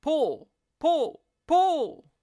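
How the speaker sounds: rising frantic yells; building yells. A narrator's reading. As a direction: leader yelling to pull harder. Leader yelling repeated command.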